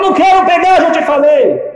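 high, loud, firm refusal. Retold or imitated. Poor woman refuses the cheque, high and loud.

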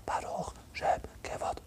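Baruch Shem Kevod whispered, slow, without voice. Retold or imitated. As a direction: breathe the line like a secret. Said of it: "vamos falar baixo". (Baruch Shem Kevod sussurrado, lento e sem voz)

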